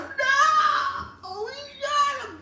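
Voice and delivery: very high crying scream; hammed-up horror, wailing; mock panicked scream. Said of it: "It was like he shot him".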